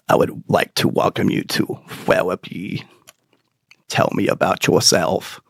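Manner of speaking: low, soft lisping therapist voice; Elmer Fudd voice. Retold or imitated. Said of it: "She talked like Elmer Fudd".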